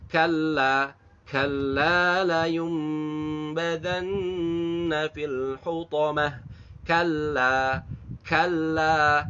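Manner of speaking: KALLA with doubled L and drawn-out A; slow measured recitation, KALLA's second vowel held evenly; two-beat long LA. Shown as a demonstration. Quran teacher models kalla, long unnasalised LA. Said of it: "La panjang dua harokat".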